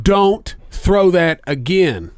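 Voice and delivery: very slow, drawn-out warning. Retold or imitated. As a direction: spell it out slowly like a final warning. Warning the pitcher once more.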